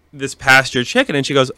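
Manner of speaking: ordinary talk, nothing marked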